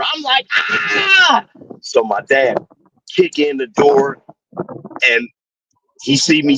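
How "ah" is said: retold scream. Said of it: "I screamed bloody murder".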